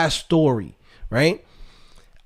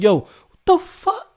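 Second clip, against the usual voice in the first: much higher, slow, stretched-out disbelief; stunned disbelief; 11 semitones higher, slower than usual. Retold or imitated. Streamer reacting to his exploding head, slow high disbelief. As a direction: let the shock hang on every word. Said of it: "I'm like".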